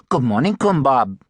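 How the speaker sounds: light, friendly assistant greeting her boss; lightly voiced Ying. Narrator voices young assistant Ying.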